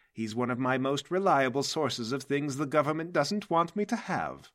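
boasting about a well-connected friend, swelling through the list; theatrical mastermind showing off an ally; Mercury, grand villain boss. Acted. Game master plays Mercury.

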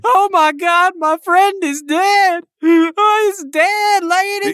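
high, loud, slow hammed-up wail; overplayed panic over a friend's body; mock distraught. Player to the lady, wailing his friend is dead in mock despair.

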